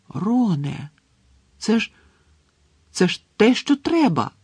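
halting start, then high and loud; thrilled disbelief climbing in pitch; stunned excitement. A narrator's reading. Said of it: "просто очманіла Герміона". (приголомшене захоплення)

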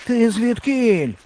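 high, slow alarmed question; startled fox. A narrator's reading. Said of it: "злякався лис". (високий переляканий голос лиса)

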